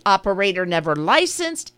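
normal talking voice

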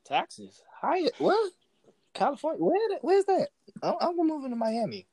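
high incredulous questions; mock disbelief. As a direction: act baffled and outraged. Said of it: "a lot of people was like".

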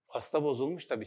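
ordinary talk, nothing marked

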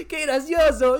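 high, loud laughing exclamation; laughing imitation. Imitating three idiots laughing at a silly word, hammed-up. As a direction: burst out laughing at nothing. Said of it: "somos tres tarados que dicen".

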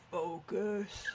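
low, quiet demon imitation; a hint of a demon teacher; imitating the demon voice. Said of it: "in a demon voice?".

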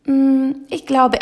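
slow, high, pondering hum; loud, deliberating "mm" before deciding; hesitant. A narrator's reading. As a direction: weigh it up aloud, drawn out. Witch hesitates over the broom.

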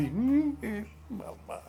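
a high whimper fading to a tiny, slow "mamá"; scared kids. Retold or imitated. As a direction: sound caught and scared, shrinking away. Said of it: "Y nosotros así".

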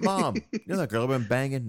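goofy son, then blurt it flat; sheepish confession, tossed off; mock son voice.

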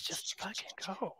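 whisper. Real, not acted. Whispered aside.

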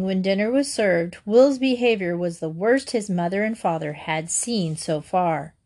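plain reading voice

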